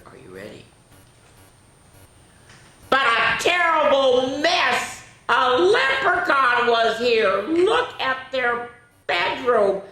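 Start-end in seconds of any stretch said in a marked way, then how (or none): 2.9-9.8 high, loud and slow exclamation; dramatic reveal. A narrator's reading. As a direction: gasp it out to the children.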